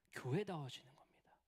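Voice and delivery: hushed whisper to drive the point home. Acted. Preacher whispering the point to the congregation. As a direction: lean in and whisper it.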